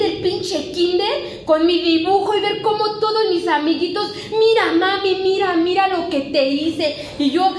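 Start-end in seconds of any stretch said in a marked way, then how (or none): 4.3-7.0 put-on a child's eager high call, higher and louder; little kid voice. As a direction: show mommy your drawing, thrilled. Child voiced showing drawing.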